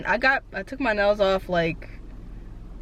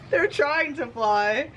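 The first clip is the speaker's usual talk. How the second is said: ordinary talk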